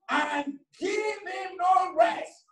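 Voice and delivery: high, drawn-out preacher's shout; fervent scripture cry. Real, not acted. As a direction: build to a soaring, stretched cry. Declaims scripture.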